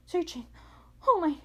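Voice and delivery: breathless-style outburst, mildly acted; gasped exclamation. A narrator's reading. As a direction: gasp it out in alarm. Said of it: "he gasped".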